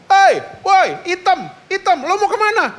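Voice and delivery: high mocking teen voice calling out; mocking schoolkid yell. Schoolmate teasing his daughter across the yard, high mocking call. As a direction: taunt her in a high teenage voice. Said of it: "Dua kali dia ngomong".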